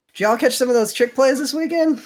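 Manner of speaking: high, loud and eager; bright burst of enthusiasm; excited. Real, not acted. Excited question to the group.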